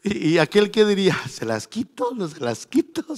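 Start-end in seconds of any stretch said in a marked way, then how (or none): 1.3-3.2 sly musing. Acted. Ananías musing, teasing.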